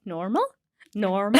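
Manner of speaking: casual Irish midwife reassurance; Irish accent. Retold or imitated. Irish midwife brushing off worries in Irish accent. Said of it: "in that Irish accent".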